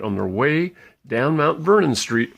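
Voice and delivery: usual speaking voice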